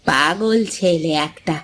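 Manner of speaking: soft, slow, low sighing to herself; a tired mother muttering alone; weary sigh. Acted. Mother sighs to herself about her stubborn son, soft and weary.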